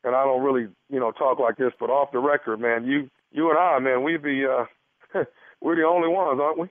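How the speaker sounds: a lightly voiced, over-familiar physician; put-on doctor. Prankster doctor talking off the record, confiding.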